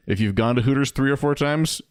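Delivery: ordinary talk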